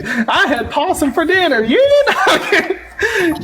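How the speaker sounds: high, laughing mock Southern line; laughing Southern accent. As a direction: quote the Uncle Daddy bit, cracking up. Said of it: "The Uncle Daddy".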